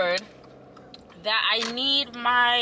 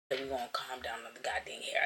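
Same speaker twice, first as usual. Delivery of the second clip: unvoiced whisper to herself; hushed aside; 9 semitones lower, 11 dB quieter, faster than usual. Real, not acted. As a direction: whisper it under your breath.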